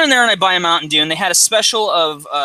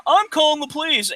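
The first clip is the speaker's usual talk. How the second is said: mocking, high-pitched whine; whiny kid; 9 semitones higher than usual. Retold teen on the floor, whining to the cashiers. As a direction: mimic a sore loser crying foul. Knocked-out kid threatens police.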